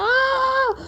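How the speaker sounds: very high, long held "aah"; shocked cry. Acted. Maya tasting ice cream, high shocked squeal.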